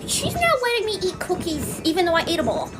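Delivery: put-on little gremlin girl. Little girl tattles in mom's room, bratty whine. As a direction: imitate a whiny kid telling on someone. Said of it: "with her little gremlin self".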